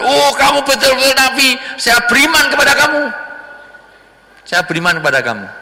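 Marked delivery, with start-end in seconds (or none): 0.0-3.0 high, eager declaration of faith; pitched high and loud, a hammed-up convert; excited young man. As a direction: gush with sudden belief. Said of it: "pemuda tadi mengatakan".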